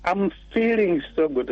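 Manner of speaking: normal talking voice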